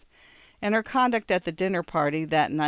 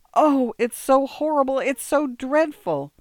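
high, loud tearful wail; sobbing; 8 semitones higher, 5 dB louder than usual. A narrator's reading. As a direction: cry it out, overwhelmed. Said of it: "Irene was sobbing".

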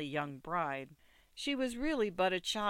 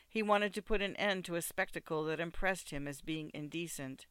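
plain reading voice

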